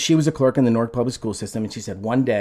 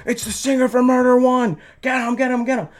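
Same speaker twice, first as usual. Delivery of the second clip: high, louder mock yell; frantic retold holler, pitched way up; imitated backstage shouting; 13 semitones higher, 5 dB louder than usual. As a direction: shout the alarm like someone backstage. You hear backstage.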